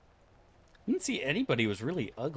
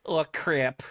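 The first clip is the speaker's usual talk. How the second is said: higher-pitched mock Wisconsin accent; hammed-up Midwestern Wisconsin voice; 6 semitones higher, 4 dB louder than usual. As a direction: put on a Wisconsinite fretting loudly. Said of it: "you're from Wisconsin and you're like".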